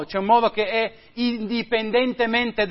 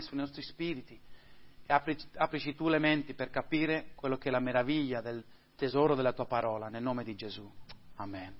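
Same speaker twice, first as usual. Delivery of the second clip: hushed, earnest prayer voice; soft pastoral prayer fading out at the amen; 2 semitones lower, 6 dB quieter than usual. Real, not acted. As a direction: pray to the Father, intimate and sincere. Opening prayer before the sermon.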